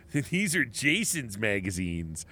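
slow, soft deadpan read; quoting Lon Friend. Retold or imitated. Retelling his quote to Rex, slow and soft. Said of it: "was quoting Lon Friend".

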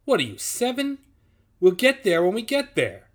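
high, loud parental exasperation; exasperated scolding. A narrator's reading. Chief scolding the impatient Nazi like a child, exasperated.

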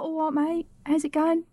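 high-pitched matey Essex greeting; Essex accent. Acted. Host showing how Beckham should sound, Essex accent. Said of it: "isn't David Beckham from Essex".

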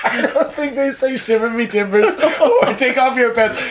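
high-pitched, laughing through the words; amused protest broken by laughter. Real, not acted. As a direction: object while cracking up. Cracking up at partner's accent.

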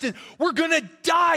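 high, mock-panicked outburst; freaking out. Disciples in the storm complaining about sleeping Jesus, acted panic. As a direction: blurt it in exaggerated alarm. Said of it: "the disciples are freaking out".

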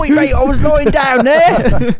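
high, loud, slow laughing British whine; mock British accent. Friends mocking the fallen defender in a high laughing British accent. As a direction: whine it like an aggrieved English footballer, laughing. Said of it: "Is he British doing a British accent".

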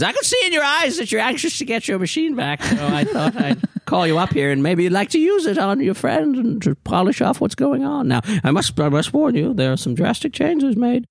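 high-pitched workshop keeper voice; eccentric ancient hostess. Acted. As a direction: explain in a high, odd character voice. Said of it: "and she says".

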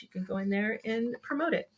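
ordinary talk, nothing marked

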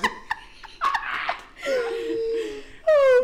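high shrieking cackle; shrieking laughter. Real, not acted. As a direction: howl with laughter. Bursting out laughing.

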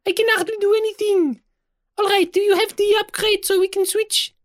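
very high, squeaky and fed up; a trapped little thing begging to switch; desperate tiny voice. Acted. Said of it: "desperate and angry Viola sounds".

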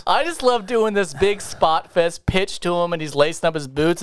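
ordinary talk

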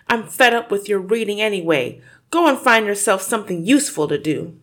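loud, fast, fed-up scolding; scolding father. Acted. Father telling his daughter off, loud and fast. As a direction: dismiss her reading harshly.

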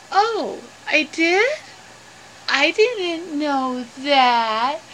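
slow, drawn-out dawning realization; sheepish surprise. Acted. Mel learning he caused his own fall, slowly surprised. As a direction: stretch it out as a puzzled character catching on.

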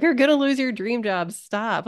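high, urgent mock cry; mock scream. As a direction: imitate a panicked inner voice, pitched high. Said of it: "her heart is screaming at her".

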